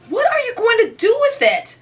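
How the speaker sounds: high, pointed and sharp; sharp question. A narrator's reading. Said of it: "asked Frances sharply".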